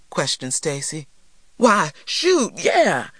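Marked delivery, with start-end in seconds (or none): 1.6-3.1 high, loud, eager yes; emphatic exclamation. A narrator's reading. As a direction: burst out with full conviction, pitched up. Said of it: "he exclaimed".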